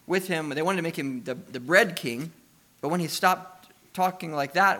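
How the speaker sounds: loud, rising sermon climax, pushing each line harder; preaching at full force. Acted. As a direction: preach it out big to the congregation.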